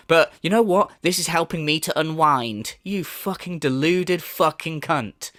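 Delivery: ordinary talk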